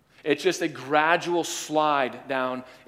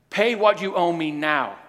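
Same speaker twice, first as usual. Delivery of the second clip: harsh demand; 5 semitones higher, 5 dB louder than usual. Acted. Freed man demands repayment.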